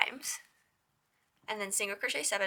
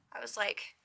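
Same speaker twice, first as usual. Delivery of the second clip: ordinary delivery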